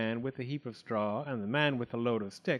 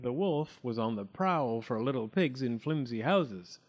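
ordinary delivery, nothing marked

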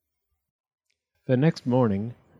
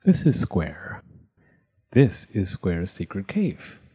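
ordinary delivery

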